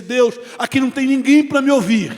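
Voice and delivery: retold cry to God. Pastor alone in his car crying out to God, desperate. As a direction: cry out alone under a tree. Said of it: "e ali eu gritei".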